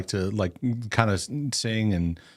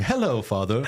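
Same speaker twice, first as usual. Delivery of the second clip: mock British greeting. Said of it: "Like my morning greeting".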